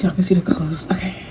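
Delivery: whispered aside; embarrassed whisper. Real, not acted. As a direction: hush it to yourself.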